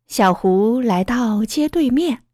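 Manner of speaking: usual speaking voice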